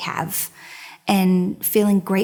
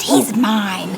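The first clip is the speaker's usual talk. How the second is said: louder, menacing cat hiss; 4 dB louder than usual. Acted. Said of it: "who hissed".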